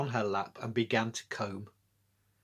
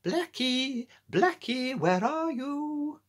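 put-on much higher, slow old lady calling her cat; 14 semitones higher, much slower than usual. A narrator's reading. Old lady at her door calling her cat, high and slow. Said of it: "came out and called".

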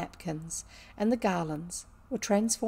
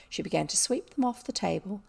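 ordinary delivery, nothing marked

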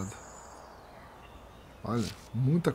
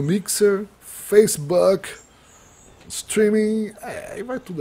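emphatic listing; 7 semitones higher, 9 dB louder, much slower than usual. Real, not acted.

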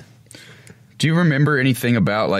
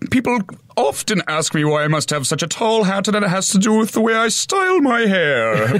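high, fussy, proper gentleman; high-pitched, fussy, laughing villain voice; prim dandy villain; 6 semitones higher than usual. Acted. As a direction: explain the tall hat with vain delicacy. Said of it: "He's got".

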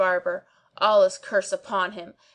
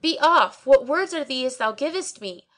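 higher, louder dismissal; indignant outcry; 5 semitones higher, 4 dB louder than usual. A narrator's reading. Narrator ordering the barber away, indignant. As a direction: shoo him off indignantly. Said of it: "cried I".

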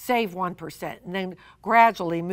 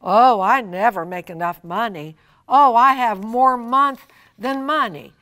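a touch of whiny grumbling, a bit louder; mock complaining; 7 dB louder than usual. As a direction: play the sighing complainer about money. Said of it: "we never wanna say".

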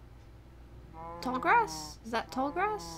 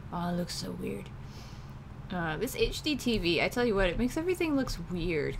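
ordinary talk

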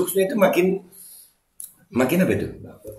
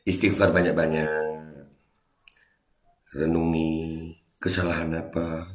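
low, slow, soft and solemn; mock-solemn spiritual guide; mock muhasabah leader; 10 semitones lower, 10 dB quieter, slower than usual. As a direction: intone it slowly like a hushed reflection session.

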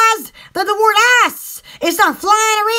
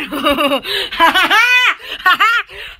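long laugh in several bursts; 2 semitones lower than usual. Real, not acted. Admiring how someone looks, laughing in bursts.

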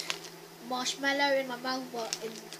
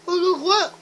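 cheeks stuffed full; 4 semitones higher, 8 dB louder, much slower than usual. Real, not acted. More grapes stuffed in.

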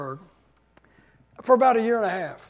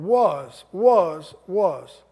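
"was" said three times, each one leaned on; stress on was; 4 dB louder, much slower than usual. Shown as a demonstration. Pastor drilling the word "was" into his congregation, repeated and stressed. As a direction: hammer the word "was" over and over. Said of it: "I want to emphasize that word".

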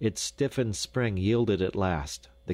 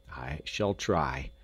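slow, lower repeat; measured, earnest resolve; deliberate; 4 semitones lower, slower than usual. A narrator's reading. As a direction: say it slowly, weighing each word.

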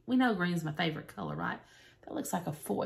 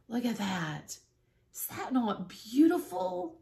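ordinary talk

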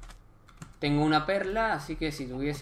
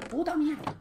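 much higher, mocking squeak; squeaky mock voice; 12 semitones higher than usual. Gamer mocking the bad map, squeaky mock voice. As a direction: squeak the curse in a silly high voice. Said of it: "Puta mierda el mapa".

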